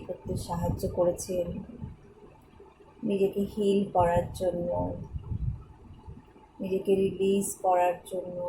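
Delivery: guided meditation. Acted. Guide leads listeners in thanks and divine healing, meditative. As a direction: lead listeners gently through gratitude and prayer, leaving space.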